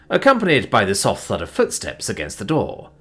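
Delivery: plain reading voice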